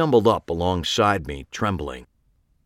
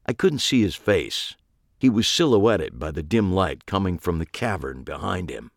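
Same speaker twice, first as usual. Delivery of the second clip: ordinary delivery, nothing marked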